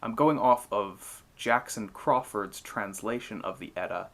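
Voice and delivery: plain reading voice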